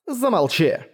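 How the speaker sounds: high sharp shout. A narrator's reading. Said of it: "крикнула она".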